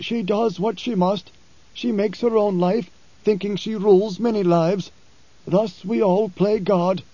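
lightly voiced preacher stating a fact. Preacher telling Halleck he is dead, calm.

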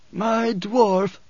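high, labored wheeze; wheezing. A narrator's reading. Othame tells Paul about his dwarf, wheezing. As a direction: wheeze it out like a frail, sick man. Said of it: "Othame wheezed".

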